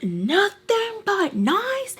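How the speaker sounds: high, drawn-out mocking echo; incredulous mockery. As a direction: throw it back slowly, high and outraged. Mocks their claim, mad.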